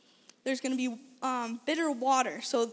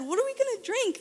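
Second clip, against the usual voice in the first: much higher, indignant demand; 10 semitones higher than usual. Retold or imitated. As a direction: whine it as a demand. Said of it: "they demanded".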